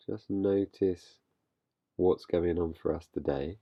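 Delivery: calm, unhurried guiding voice with long pauses; guided meditation. Real, not acted. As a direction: gently lead listeners into stillness, leaving space between phrases. Leads a meditation.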